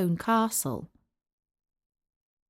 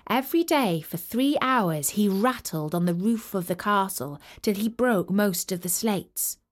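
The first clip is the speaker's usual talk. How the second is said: usual speaking voice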